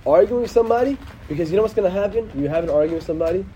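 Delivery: ordinary talk, nothing marked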